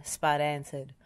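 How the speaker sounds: usual speaking voice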